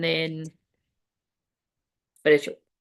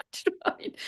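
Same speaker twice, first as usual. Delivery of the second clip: laughing; 8 dB quieter, much faster than usual. Real, not acted. Laughing aside to viewers.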